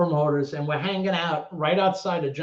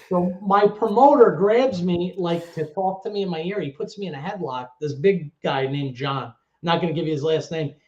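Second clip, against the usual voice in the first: usual speaking voice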